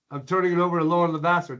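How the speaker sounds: ordinary talk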